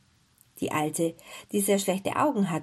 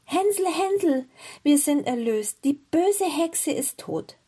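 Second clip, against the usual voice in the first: high, loud cry of good news; excited girl calling to her brother; joyful calling out; 7 semitones higher, 4 dB louder than usual. A narrator's reading. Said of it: "öffnete sein Ställchen und rief".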